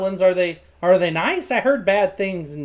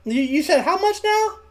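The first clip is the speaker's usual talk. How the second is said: much higher, incredulous question; barely contained excitement; 10 semitones higher than usual. Acted. Boy asks apprentice wage again, bursting with excitement. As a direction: voice shoots up, trying to hold it in. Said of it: "He, he tries to hold back his unbridled excitement".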